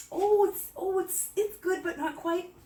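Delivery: high, drawn-out doubtful tasting voice; hesitant taster. Retold or imitated. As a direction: sound unsure about the taste. Acts out hesitant tasting reaction.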